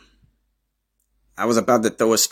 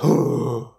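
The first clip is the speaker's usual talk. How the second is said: sharp, higher imitated gasp of shock; crowd gasp; 6 semitones higher, 4 dB louder than usual. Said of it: "Everybody like".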